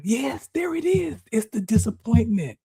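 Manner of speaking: high-pitched, relieved discovery; excited middle mind. Retold or imitated. Middle mind spotting the hidden cause, high and excited. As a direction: announce the find, pitched well up. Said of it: "my middle mind's like".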